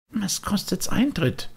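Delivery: put-on high, longing puppet boy; a boy pulled toward the music; wistful high boyish voice; Bengele's voice. Bengele asks.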